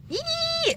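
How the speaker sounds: high, sharp grossed-out squeal; a short, high squeal of disgust. Retold or imitated. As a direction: squeak in revulsion at the food. Recoiling from shrimp eyeballs.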